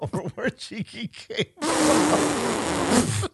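high, slow mocking echo; teasing drawn-out repeat. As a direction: draw it out to tease him.

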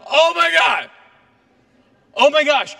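high, loud cry of amazement; amazed outcry. Retold or imitated. The healed woman crying out in loud amazement. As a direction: cry out as the healing hits her. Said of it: "And she's like".

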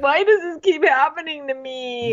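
high mock self-pitying whine; Colton. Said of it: "He's like".

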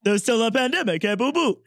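lightly voiced cartoon bear; a hint of the bear voice, still giggling; Yogi Bear voice. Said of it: "kinda like Yogi Bear".

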